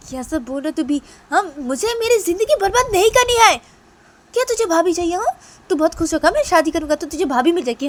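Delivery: high, loud scolding rant; angry shouting. Acted. Sister furious at brother's wish for a bhabhi. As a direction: tear into him at the top of the voice.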